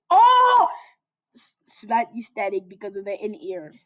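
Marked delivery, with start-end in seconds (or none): 0.1-0.7 very high, loud gasp; surprised cry. Real, not acted. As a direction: yelp it out. Sudden exclamation.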